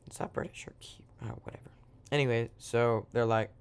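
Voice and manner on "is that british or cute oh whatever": very soft, whispery aside; muttered aside. Real, not acted. Storyteller doubts own accent, whispery aside. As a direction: drop to a near-whisper, as if to yourself.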